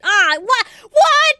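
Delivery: loud high outraged what; shocked shout. Real, not acted. Player shocked at the game's twist, loud high shout. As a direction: yell it in disbelief.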